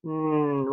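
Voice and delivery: "N" as a long, soft nasal hum, nnn; isolated final sound. Shown as a demonstration. Teacher prompting for the last sound, isolated and held. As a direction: hold just the "N" sound without a vowel. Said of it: "What's that final sound you hear?".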